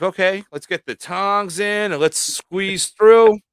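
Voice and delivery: slow nervous self-talk; slow and careful. Retold or imitated. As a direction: ease each word out like reaching in with tongs. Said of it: "now you're like".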